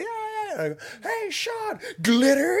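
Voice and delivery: very high, gleeful calls, loud on glitter; squealed party greeting building to a yelled glitter; gleeful hail. Retold or imitated. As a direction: hail your buddy before the prank. Springing glitter on arriving friend.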